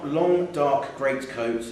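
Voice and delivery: plain reading voice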